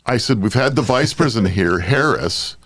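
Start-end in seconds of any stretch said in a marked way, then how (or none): none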